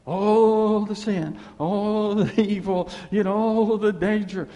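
slow, loud, emphatic list; slow emphatic preaching. Real, not acted. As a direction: spell out each word for weight.

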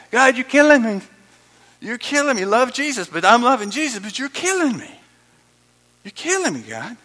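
loud, hammed-up protest to God; half-joking cry of despair; mock anguished complaint.

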